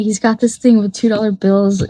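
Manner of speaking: ordinary delivery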